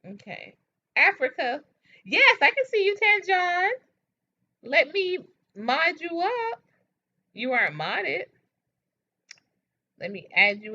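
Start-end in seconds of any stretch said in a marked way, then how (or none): 2.1-6.6 excited greeting. Real, not acted. Greeting chat viewer excitedly.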